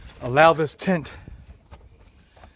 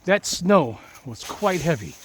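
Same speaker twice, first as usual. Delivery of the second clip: usual speaking voice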